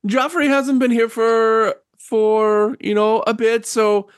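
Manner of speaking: put-on high, hesitant elderly lady; old woman relenting. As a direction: concede haltingly in an old-lady voice. Game master plays old woman.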